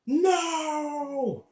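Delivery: high, loud retold scream of a possessed man. Imitating a possessed man at a deliverance, screaming no. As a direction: yell it like someone in the grip of a spirit. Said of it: "another guy was going".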